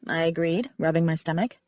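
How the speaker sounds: ordinary delivery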